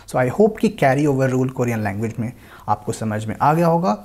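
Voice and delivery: ordinary talk, nothing marked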